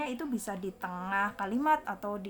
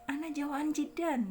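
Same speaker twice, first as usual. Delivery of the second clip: "Ana jau'an jiddan" higher, falling at the end; natural conversational intonation; 6 semitones higher than usual. Shown as a demonstration. Teacher models dialogue for students, conversational intonation. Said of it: "intonasinya seperti".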